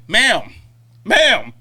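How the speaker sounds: loud, cold "Ma'am, ma'am" like an insult; pointed ma'am. Retold or imitated. Server answering an angry customer with a loud, cutting ma'am. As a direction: bite down on each ma'am. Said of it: "It's almost like, "Bitch".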